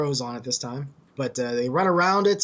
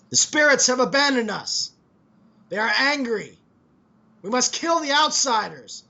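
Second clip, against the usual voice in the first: high, loud cultist shout; rally the crowd against outsiders; zealot yelling; 9 semitones higher, 6 dB louder than usual. Acted. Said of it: "they're sort of yelling".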